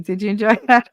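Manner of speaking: ordinary delivery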